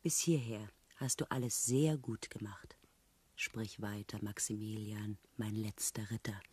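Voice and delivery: soft, whispered coaxing; intimate whisper. Acted. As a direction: draw him out in a hush. Intimate coaxing of her fiancé.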